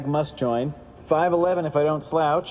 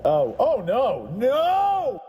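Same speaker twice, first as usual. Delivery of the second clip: panicked rising scream; slow then a high shriek; terrified scream; 11 semitones higher, 4 dB louder, much slower than usual. Acted. Victim realising the trap.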